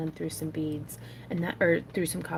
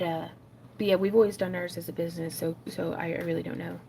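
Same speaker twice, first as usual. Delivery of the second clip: ordinary talk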